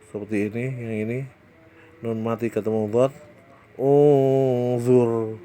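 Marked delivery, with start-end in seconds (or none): 3.8-5.4 "Unzur" recited louder, the n blurred into a hum before the z; Quran recitation. Shown as a demonstration. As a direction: chant "Unzur" with a hidden nasal. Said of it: "Nunnya tidak begitu jelas". (lantunkan "Unzur" dengan nun samar berdengung)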